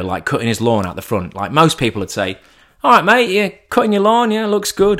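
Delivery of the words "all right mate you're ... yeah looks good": high, casual neighbourly patter; chummy neighbour. Acted. Greeting a neighbour mowing his lawn, chummy small talk. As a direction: toss out small talk, put-on cheery. What most people say to neighbour.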